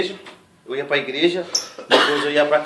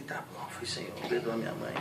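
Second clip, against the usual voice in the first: whispered prayer; 4 semitones lower, 13 dB quieter than usual. Retold or imitated. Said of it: "Aí eu falei".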